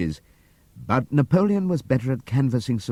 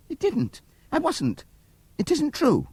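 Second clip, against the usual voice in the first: high, frantic mare's denial; Molly crying out. A narrator's reading. Molly crying out her denial to Clover. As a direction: deny it in a high, rising panic. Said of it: "cried Molly".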